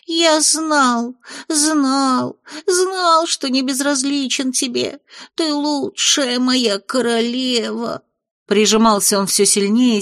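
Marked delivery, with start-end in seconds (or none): 0.1-8.0 high, overjoyed imp voice; gushing imp. Acted. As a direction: gush with delighted adoration.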